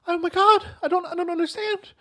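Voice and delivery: put-on high, rushing old lady voice; high worried old lady; alarmed elderly woman. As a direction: panic gently like a grandma, speeding up. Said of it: "old woman's reply".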